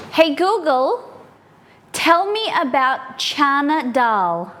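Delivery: drawn out so the machine finally gets it; slow careful enunciation. Real, not acted. Cook re-asks the misheard smart speaker, slow and deliberate.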